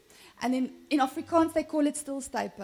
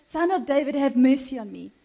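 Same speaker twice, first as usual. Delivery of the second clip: louder imitated plea to Jesus; crying out; 7 dB louder than usual. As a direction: call out for mercy over a crowd. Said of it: "So he cries out to Jesus".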